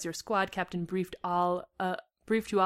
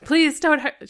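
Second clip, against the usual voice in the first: high, loud desperate plea; cried plea; 8 semitones higher, 8 dB louder, slower than usual. A narrator's reading. Liz to the robbers, crying out desperately. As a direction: cry out, begging. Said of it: "you cried".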